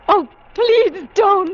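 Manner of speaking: high, loud and slow, begging; frightened plea. Acted. Millie begs John to keep Nicholas away, frightened plea. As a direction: plead with him, scared for your life.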